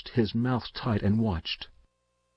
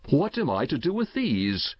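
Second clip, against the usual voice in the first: mild exclamation, pitched higher; crying out; 5 semitones higher than usual. A narrator's reading. Uncle waving the winnings at the boy, mildly crying out. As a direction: exclaim it with a touch of bewilderment. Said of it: "he cried".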